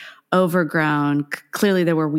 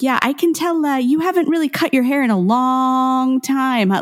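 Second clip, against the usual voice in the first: long stretched and leaned on, voice higher; stress on long; 7 semitones higher than usual. Retold or imitated. Stylist teasing her about a long time, stressing long. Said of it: "emphasis on the long".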